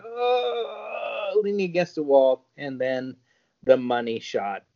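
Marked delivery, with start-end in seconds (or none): 0.0-1.4 mock moan. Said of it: "her going".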